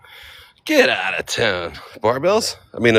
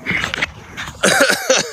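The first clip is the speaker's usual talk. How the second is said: cough. Real, not acted. Coughing while exercising.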